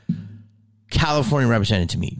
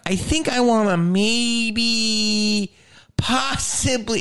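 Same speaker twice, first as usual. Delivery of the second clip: high, slow, drawn-out tentative wish; hammed-up hesitant daydreamer; tentative dreamer; 11 semitones higher, much slower than usual. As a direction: stretch it out like a cautious dreamer. Voices a timid Philly dreamer.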